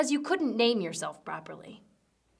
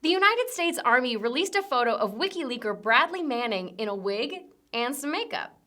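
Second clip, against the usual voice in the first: ordinary talk, nothing marked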